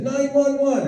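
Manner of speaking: put-on very high little-kid voice; high, eager child's answer; mimicked little girl. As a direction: pipe it up like a preschool girl.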